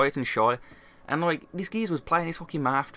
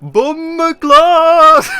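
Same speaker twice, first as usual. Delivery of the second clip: very high, drawn-out holler; hugely stretched, high-pitched imitation scream; mock yell; 15 dB louder, much slower than usual. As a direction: wail it out at full volume like someone fleeing. Imitates rival yelling while running off.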